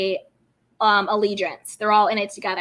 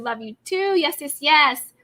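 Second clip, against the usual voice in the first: usual speaking voice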